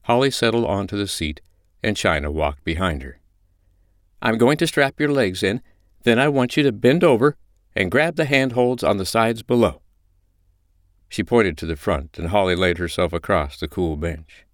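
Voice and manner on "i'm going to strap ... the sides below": high-pitched, controlled instructing voice; composed dominant mistress. Acted. China shows Holly the bench, in command. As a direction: instruct your submissive with easy authority.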